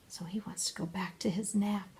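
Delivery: unvoiced hushed aside; whisper. Real, not acted. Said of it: "whispered aside".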